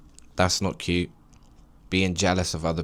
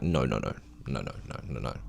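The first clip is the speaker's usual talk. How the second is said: low, soft mock refusal; 8 semitones lower, 8 dB quieter than usual. Vlogger voicing God to the viewers, low and soft refusal. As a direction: drop way down and go softer. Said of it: "He's like".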